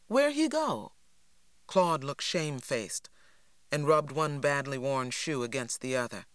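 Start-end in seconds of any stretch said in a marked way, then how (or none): 0.1-0.9 Stacy's voice. A narrator's reading.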